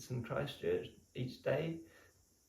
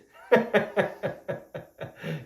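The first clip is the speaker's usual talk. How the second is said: imitated guru laugh; 8 semitones higher, 10 dB louder than usual. Guru laughing off his ego.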